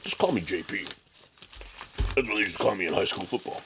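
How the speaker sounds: a hint of a jock seller voice; JP impression. Mocking the seller who packed the comic, lightly voiced bro. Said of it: "that's my J P impression".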